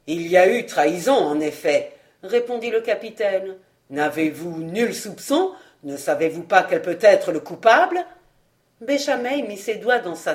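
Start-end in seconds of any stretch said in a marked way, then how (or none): 0.1-1.9 captain's voice. Acted. Captain to the intendant, low and firm. 3.9-8.1 lower, pressing officer; captain's voice. Acted. Captain questioning the intendant, low and firm.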